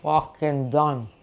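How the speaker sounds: talking through a mouthful; words round a bite of food; mouth full. Real, not acted. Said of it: "Talk with my mouth full".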